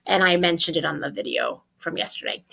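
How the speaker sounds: usual speaking voice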